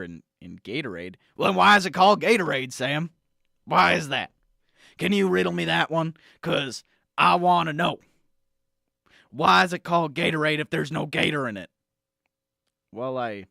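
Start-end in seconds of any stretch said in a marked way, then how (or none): 1.4-11.7 blustery talk-radio conspiracy crank; conspiracy pundit rant. Acted. Pundit badgering the host Sam about Gatorade, blustery rant. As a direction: rant like a paranoid supplement salesman.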